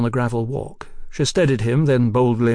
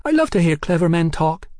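high girlish voice with put-on cheer; sweet talk that rings hollow; falsely bright; 6 semitones higher, 3 dB louder than usual. A narrator's reading. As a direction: flatter him with brittle brightness. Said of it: "in a voice as bright as peeling paint".

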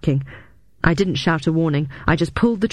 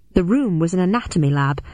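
ordinary delivery

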